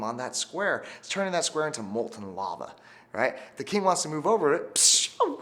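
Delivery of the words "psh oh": mock alarm. King burning on lava square.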